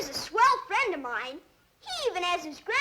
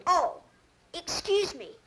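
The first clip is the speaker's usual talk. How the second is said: normal talking voice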